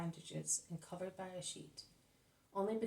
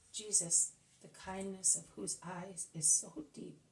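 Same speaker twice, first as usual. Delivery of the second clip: plain reading voice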